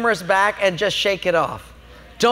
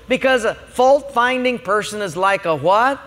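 usual speaking voice

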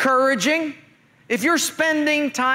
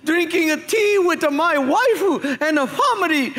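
put-on Japanese accent; 5 semitones higher than usual. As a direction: joke Japanese accent, pitched higher. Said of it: "The first guy's a Japanese guy".

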